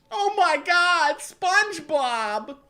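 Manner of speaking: high, loud, drawn-out scream. Acted. Squirrel seeing into the room, high horrified scream. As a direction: scream it in theatrical horror. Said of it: "looked in the room and screamed".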